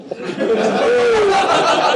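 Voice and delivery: high-pitched burst of laughter. Real, not acted. Recounting the prank call to the host, high laughter. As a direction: laugh helplessly, high and breathless. Laughing at his own story.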